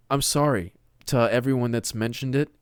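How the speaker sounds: ordinary delivery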